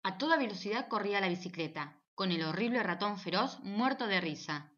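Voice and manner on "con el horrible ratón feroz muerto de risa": ordinary delivery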